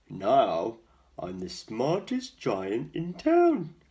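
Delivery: booming proud giant, slow and loud. A narrator's reading. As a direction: puff up like a giant showing off his clothes. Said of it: "he said proudly".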